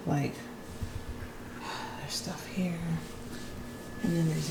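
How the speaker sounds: whispered on a sigh; exhausted whisper under the breath; breathless whisper. Real, not acted. Winded, sighing at the clutter.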